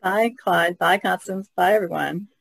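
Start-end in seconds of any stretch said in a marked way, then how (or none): none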